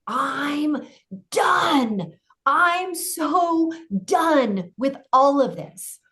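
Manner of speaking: crying out "I'm done" high, loud and slow; roaring. Retold or imitated. Her inner voice finally roaring, done with it all. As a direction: let the inner voice roar out through tears. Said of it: "it became this, like, roar".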